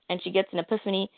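ordinary delivery

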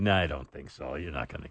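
low, quiet dismissive verdict; low jury voice. Retold or imitated. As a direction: brush it off flatly.

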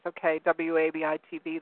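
ordinary talk

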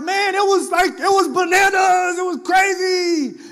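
high, slow, shouted excitement; hyped shout. Retold or imitated. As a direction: yell it out, still hyped from the service. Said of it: "I was like".

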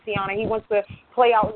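normal talking voice

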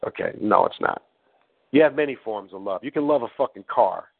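ordinary delivery

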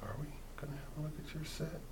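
a hushed tease to the audience; whisper. Real, not acted. Host asks the guest about his set, whispering. Teasing the upcoming clip.

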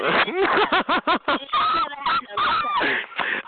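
very high, long cackle; gleeful mocking laugh. Real, not acted. Streamer enjoying own taunt, high cackle. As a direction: cackle high until it falls away.

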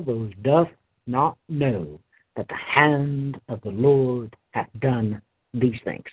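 measured, solemn Bible reading with weighty pauses; scripture reading. Acted. Preacher reading Exodus to his congregation, solemn and measured. Preacher reads Job chapter twelve.

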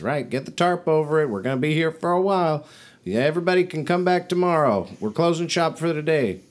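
high, quick calls to the whole crew; yelled orders. Acted. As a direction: announce closing time across the market. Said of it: "she yells at, to all her, her traders".

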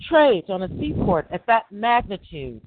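usual speaking voice

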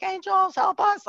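high sing-out plea for help; calling on angels. Retold or imitated. Speaker appealing to angels in front of skeptical Gina, high mock plea.

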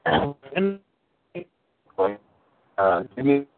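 glitchy robotic call audio; distorted like a bad video call. Real, not acted. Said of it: "You sound like a robot again".

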